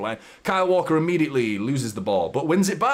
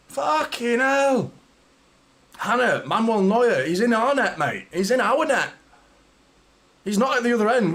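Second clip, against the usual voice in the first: stunned relief; 8 semitones higher than usual. Real, not acted. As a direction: marvel at the keeper, still reeling. After the saves, awed relief.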